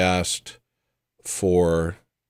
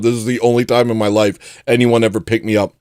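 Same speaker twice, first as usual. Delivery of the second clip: usual speaking voice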